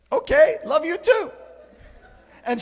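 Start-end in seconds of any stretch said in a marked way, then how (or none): 0.1-1.3 awkward reply. Retold or imitated. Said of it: "I just kinda stood there like".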